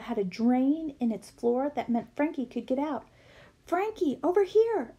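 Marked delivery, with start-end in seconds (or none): 3.7-4.9 yelled. A narrator's reading. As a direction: shout to Frankie to come over. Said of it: "I yelled".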